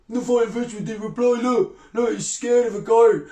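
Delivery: high, put-on taunting voice; mocking troll impression. Fisherman mimicking a troll who gloats at no reply, mocking. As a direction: mimic a crowing troll, pitched right up and dragged out at the end.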